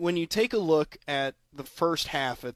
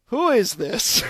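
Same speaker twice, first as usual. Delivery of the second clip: confused stranger; 7 semitones higher, 6 dB louder, slower than usual. Retold or imitated. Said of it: "And he goes".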